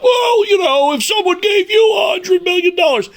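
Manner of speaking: high, shouted sneer at TV pundits; sarcastic shouted parody of Kirk Herbstreit-style commentators; mocking pundit voice. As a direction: shout it like a smug analyst excusing greed. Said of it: "all of them talk about".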